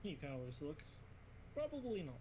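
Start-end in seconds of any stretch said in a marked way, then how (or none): 1.6-2.2 put-on high, loud Russian accent; clipped Russian accent reply. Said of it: "why am I doing a Russian accent".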